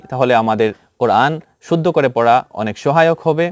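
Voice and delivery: ordinary talk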